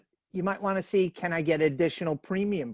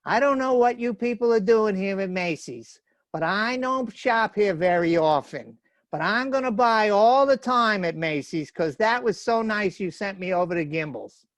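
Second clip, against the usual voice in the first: put-on old-time New York accent, raised voice; 3 semitones higher, 4 dB louder than usual. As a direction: play an emphatic New York shopper addressing the manager. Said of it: "You like the New York accent there?".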